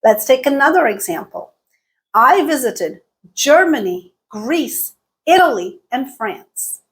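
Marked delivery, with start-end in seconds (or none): 2.1-6.8 GERMANY, GREECE, ITALY lifted, FRANCE falling; rise-fall list intonation. Shown as a demonstration. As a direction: raise each country, then drop on FRANCE. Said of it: "had rising intonation".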